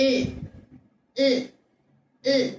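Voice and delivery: "இல்" as a held L with the tongue curled. Shown as a demonstration. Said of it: "நாக்கை நல்லா மடிச்சு வச்சு". (நாக்கை மடித்து "இல்" ஒலி)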